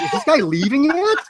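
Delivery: high-pitched, incredulous mock wondering; exasperated disbelief. Retold impatient aside to himself.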